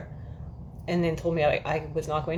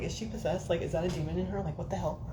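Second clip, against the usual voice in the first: inner thought; 7 dB quieter than usual. Retold or imitated. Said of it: "I'm like".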